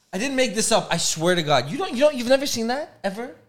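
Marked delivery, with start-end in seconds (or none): none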